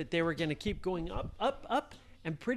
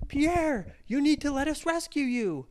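calling out; 9 semitones higher, 6 dB louder than usual. Acted. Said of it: "they said".

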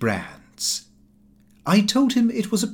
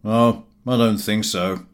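a lightly voiced, offhand Gowing; dry Gowing; 4 semitones lower than usual. A narrator's reading. Gowing dismissing the host's excuse, offhand. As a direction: brush it off flatly. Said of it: "He merely replied".

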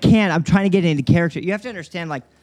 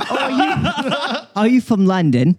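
laughing, higher-pitched London accent attempt; requested London accent; 7 semitones higher, slower than usual. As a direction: put on a London accent through a giggle. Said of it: "Could you say".

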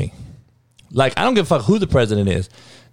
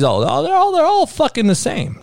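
ALL pushed way up high in pitch, over and over; heated rant stressing all; emphatic all; 13 semitones higher than usual. Real, not acted. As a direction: hammer the word all, pitch jumping up. Ranting that politicians are alike.

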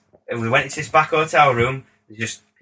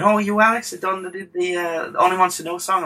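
higher-pitched imitation of excited fans; 8 semitones higher than usual. Girls approaching the producer about his song, imitated. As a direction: lightly mimic women coming over, voice raised.

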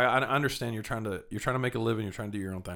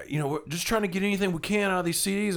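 much higher mock voice; imitated hustler; 8 semitones higher than usual. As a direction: explain the hustle. Street seller explaining.

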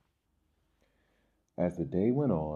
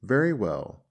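higher, louder narrated sneer; 5 semitones higher, 8 dB louder than usual. A narrator's reading. Ant turns away from grasshopper, sneering. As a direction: dismiss them with a sneer. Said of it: "sneered the ant".

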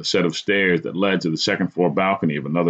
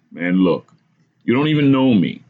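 raised, insistent warning; stern warning; 4 semitones higher, 4 dB louder than usual. A narrator's reading. Narrator warns the madman he is a stranger, sternly. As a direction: warn him off with a firm push. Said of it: "I warned sternly".

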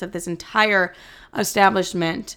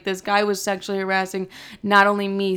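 normal talking voice

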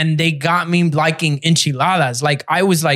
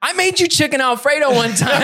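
put-on girlfriend's reproach; 7 semitones higher than usual. Girlfriend voiced accusing.

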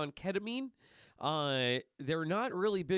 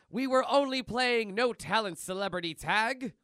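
Cheese replying to cop; 5 semitones higher, 5 dB louder than usual. Acted. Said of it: "And Cheese replies with".

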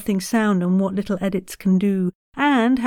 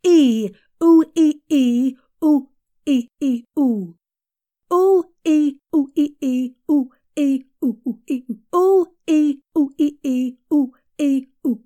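vocal warm-up; 5 semitones higher, 4 dB louder than usual. Shown as a demonstration. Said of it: "try some high to low sirens".

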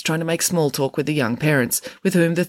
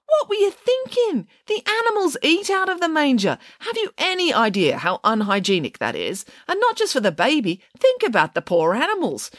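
high-pitched, indignant telling-off; appalled scolding; 7 semitones higher than usual. A narrator's reading. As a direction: scold in disbelief, starting very high. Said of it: "accused Judith Piggins".

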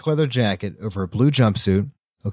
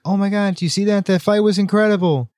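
imitating a thrilled fan, pitched high; high, breathless-with-excitement impression of a woman; excited Kim; 9 semitones higher, faster than usual. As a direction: gush in a high voice, mimicking her amazement. Kim runs over, thrilled by fight.

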